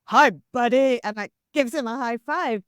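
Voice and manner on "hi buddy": louder, puffed-up manly greeting; mock gruff dude greeting; hearty buddy voice. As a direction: pump it up like a big mate. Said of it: "his voice, like, goes down, like, two octaves".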